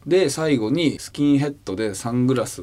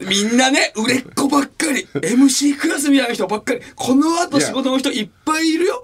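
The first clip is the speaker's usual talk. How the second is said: 高めの声で強くまくし立てる; 周りの先輩を挙げて相方を責める; 熱く抗議; 11 semitones higher, 4 dB louder than usual. Real, not acted. 相方への抗議. (高い声で相方に熱く抗議する)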